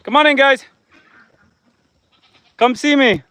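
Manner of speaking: high, loud coaxing call; calling the goats. Real, not acted. Owner calling his goats back across the yard, high coaxing call.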